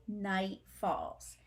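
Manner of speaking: plain reading voice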